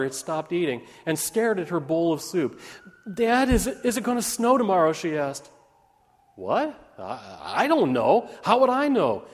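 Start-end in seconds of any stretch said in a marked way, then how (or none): 3.1-4.9 put-on high, loud little-girl voice; voiced young daughter, higher and quicker; anxious child's question. A narrator's reading. 6.3-9.2 puzzled, stammering, rising in pitch; baffled father. A narrator's reading. Father's puzzled reply acted out.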